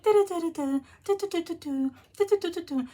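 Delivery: put-on high-pitched babyish babble. Showing how the young lady at work talks, baby voice. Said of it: "like, a baby voice".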